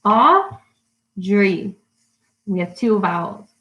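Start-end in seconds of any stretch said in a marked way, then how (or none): 0.0-1.8 "Audrey" split into Au, pause, drey; syllable by syllable. Shown as a demonstration. Teacher showing latecomers the two syllables, "Audrey" split apart. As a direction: say "Audrey" in two clear beats. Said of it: "my name has two syllables".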